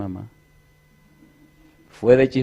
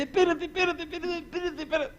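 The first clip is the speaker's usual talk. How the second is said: panicked wife; 10 dB quieter than usual. Retold or imitated.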